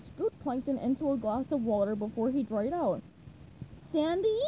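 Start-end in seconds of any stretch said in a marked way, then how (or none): 3.9-4.4 higher, rising call; questioning high cartoon call; SpongeBob. A narrator's reading. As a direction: call out to see who is there. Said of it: "SpongeBob called".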